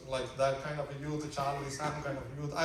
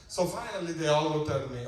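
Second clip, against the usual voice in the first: ordinary talk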